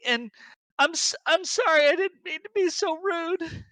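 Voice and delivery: a frightened lookout weeping out her apology; sobbing apology. Acted. Nadia breaks down crying to Barb, high and tearful. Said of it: "she's just like".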